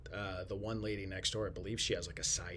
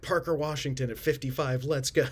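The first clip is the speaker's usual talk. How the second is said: yelling picks; 6 semitones higher, 10 dB louder than usual. Retold or imitated. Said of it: "he hears, like".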